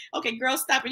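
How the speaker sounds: ordinary delivery, nothing marked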